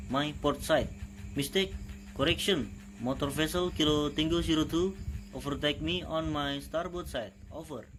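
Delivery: calm radio operator call; marine radio. Shown as a demonstration. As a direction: read it like a VHF radio exchange. Radio call demo dialogue.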